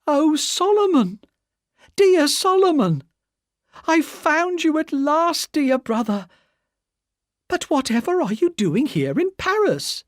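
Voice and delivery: a sister spotting her lost brother, calling out high; loud cry. A narrator's reading. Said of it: "cried out in a loud voice".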